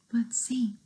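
unvoiced whisper. Whispered to listeners.

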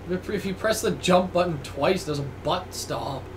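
ordinary talk, nothing marked

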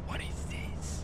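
hushed, voiceless question; stunned whisper. Real, not acted. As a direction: whisper it in alarm. Hushed after the shock.

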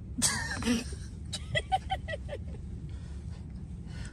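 wheezing silent laugh; high breathy laugh. Real, not acted. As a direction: laugh without voice. Laughing at backwards talk.

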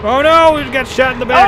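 high, loud panicked cry; alarmed shout. Real, not acted. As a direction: yell out in alarm. Shot in the back in game.

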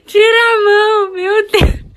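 very high, loud squeal; shrieked protest. Real, not acted. Telling companion to take the hand away, high shrieking protest. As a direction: shriek it at full voice.

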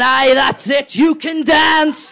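loud, high shouting tree, slowing right down; mock shout. The tree shouting at dancing Theresa May, loud and high. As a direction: bellow it over the music. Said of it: "the tree is going".

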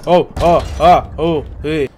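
frightened loud gasps, words stuck; real fright. Real, not acted. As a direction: blurt it out, jolted by a scare. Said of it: "So scared, couldn't even get the words out".

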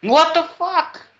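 high, slow, drawn-out disbelief; stunned. Real, not acted. Gamer reacting to a glitch with friends, stunned. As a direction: let the shock stretch the words. Something weird happens.

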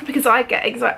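ordinary delivery

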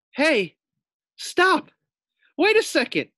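high, loud alarmed cries; panicked protest. A narrator's reading. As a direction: squeal in panic to make her stop. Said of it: "thought Fluffy".

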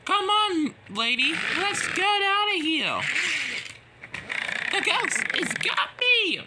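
high-pitched urgent yell; panicked cry. Acted. Engines urge each other to flee the ghost, panicked. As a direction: call out as you flee in fright.

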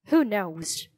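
ordinary delivery, nothing marked

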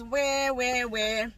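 put-on high whiny baby asking; crying baby voice. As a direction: wail it like a lost baby. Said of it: "So the baby cries".

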